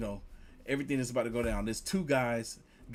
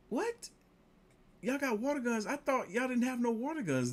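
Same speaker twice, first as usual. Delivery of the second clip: much higher incredulous what; mock shock; 12 semitones higher than usual. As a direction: squeak it out in disbelief. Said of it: "I'm like".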